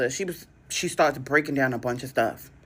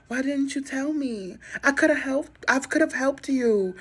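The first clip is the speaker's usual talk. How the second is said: put-on distraught girlfriend; 8 semitones higher, much slower than usual. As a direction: ham up the heartbroken girlfriend. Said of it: "I gotta play the distraught girlfriend".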